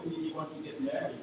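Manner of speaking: ordinary talk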